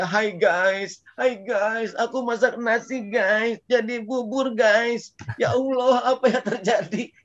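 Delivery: high, slow whiny vlogger voice; mock vlogger. Preacher mocking a vlogger crying over burnt rice, high and drawn out. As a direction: ham up a dramatic influencer.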